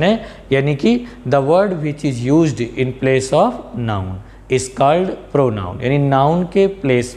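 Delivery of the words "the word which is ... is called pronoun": very slow, each word spaced out for notes; very slow dictation. Shown as a demonstration. Teacher dictating the definition to students, very slow. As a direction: dictate it slowly so students can write.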